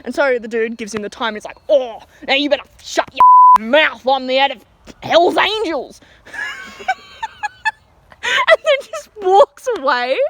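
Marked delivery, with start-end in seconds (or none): 1.7-6.0 loud, slow, hammed-up biker threat; imitated aggressive boast; mock menacing shout. Customer's threat, acted out. 6.3-10.2 high, slow words through laughter; cracking up at the punchline; helpless laughter. Real, not acted.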